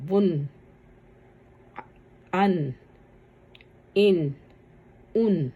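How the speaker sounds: each syllable said slowly and apart, ending on a clear nasal n like بن; tanween drill. Shown as a demonstration. Teacher drilling students, sounding out each nasal ending. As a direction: recite بن فن ہن with a ringing n at the end. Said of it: "پھر آگے دیکھیں".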